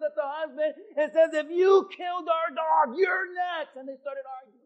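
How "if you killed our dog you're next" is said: shouted, higher threat; threatening wife. Acted. Wife threatens husband in the car, shouting.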